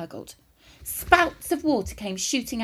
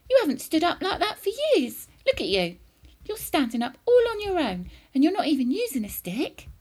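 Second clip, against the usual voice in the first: raised pitch, lightly excited; boy's excited cry; 6 semitones higher, 3 dB louder than usual. A narrator's reading. George amazed grandma stands unaided, exclaiming excitedly. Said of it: "George cried".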